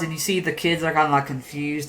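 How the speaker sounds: usual speaking voice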